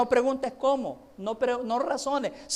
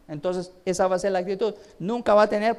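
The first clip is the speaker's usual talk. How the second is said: ordinary delivery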